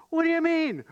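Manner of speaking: high-pitched indignant outburst; incredulous. Acted. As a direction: throw it back in disbelief. Disciples' panicked retort to Jesus.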